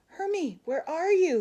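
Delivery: high loud call upward; loud high searching call. Acted. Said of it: "he called".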